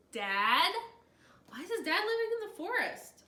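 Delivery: puzzling aloud, thrilled; excited disbelief. Real, not acted. Reader reacts to the dad reveal, excited.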